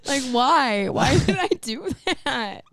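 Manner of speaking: high, loud exasperated cringe; embarrassed, laughing disbelief; mortified. Real, not acted. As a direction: wail at your own past stupidity. Cringing at own childhood memory.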